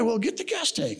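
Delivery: high-pitched mock demand; retold demand. Retold argument over a car.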